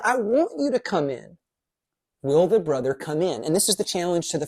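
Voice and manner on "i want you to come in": pleading father. Retold or imitated. Father pleading with older son.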